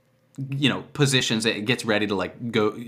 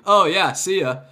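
higher-pitched, casual goodbye; composure dropped; 7 semitones higher than usual. Acted. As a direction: lose the guard's composure on a loose farewell. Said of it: "totally loses composure".

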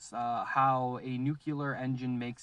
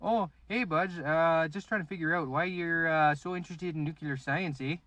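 put-on Canadian accent; 4 semitones higher, 3 dB louder than usual. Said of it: "a Mountie come knock".

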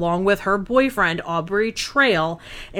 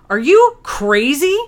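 outraged; 7 semitones higher, 6 dB louder, slower than usual. Real, not acted. Outrage at judge's ruling.

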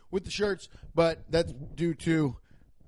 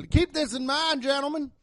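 Southern cop voice; 6 semitones higher than usual. Acted. Cop warns his officers to pray, grave Southern voice. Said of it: "I do that Southern voice".

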